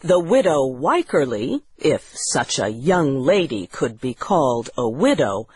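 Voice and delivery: ordinary delivery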